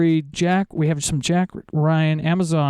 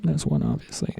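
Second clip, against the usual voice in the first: breathy low whisper; whispered aside; 11 semitones lower, 4 dB quieter than usual. Real, not acted. Unvoiced aside.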